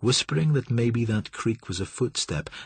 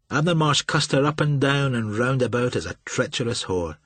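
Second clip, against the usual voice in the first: plain reading voice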